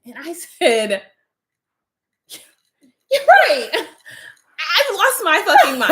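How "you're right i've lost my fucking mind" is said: laughing. Retold or imitated. Laughing retelling her own reply.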